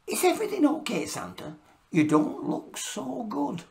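high, concerned little elf; lightly voiced worried elf.

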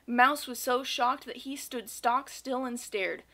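usual speaking voice

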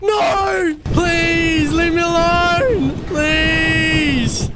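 high shouted begging; hammed-up panic. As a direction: scream for mercy like in a horror film. Chased by monster.

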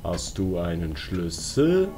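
ordinary talk, nothing marked

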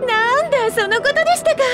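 bright-eyed relief, pitched high; relieved realization. Acted. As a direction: let the voice lift in eager recognition. Relieved on learning what is asked. (ほっとして弾んだ高い声)

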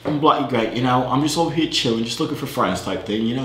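easygoing British chatter; put-on British accent. Looking for friends out in Australia, British accent. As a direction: chat away like a casual Brit. Poses as a Brit online.

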